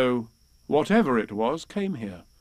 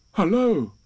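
higher Pooh hello; 7 semitones higher, 4 dB louder than usual. A narrator's reading. Pooh repeats his greeting to the animal, higher falling hello. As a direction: greet once more as Pooh. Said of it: "Pooh was just going to say".

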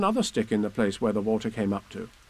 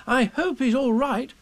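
put-on high, slow boy's voice; worried boy; 13 semitones higher, 3 dB louder than usual. A narrator's reading. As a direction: fret aloud as a little boy. Boy worries about Pooh.

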